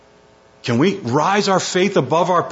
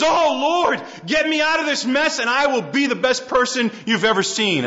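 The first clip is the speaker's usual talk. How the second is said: much higher cry of Oh Lord, then a begging rush; mock pleading; 10 semitones higher than usual. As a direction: ham up a desperate bargain with God. Voicing the flesh's bargain.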